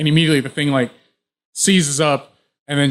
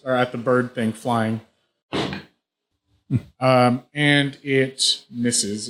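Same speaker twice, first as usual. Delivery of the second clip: ordinary delivery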